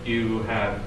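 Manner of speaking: usual speaking voice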